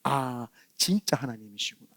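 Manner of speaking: a reverent murmur of discovery; hushed awe. Retold or imitated. Quiet realization of real God.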